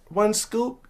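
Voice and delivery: put-on high child voice rising in disbelief; little boy checking the rule; kid voice. Kid questioning dad's rule.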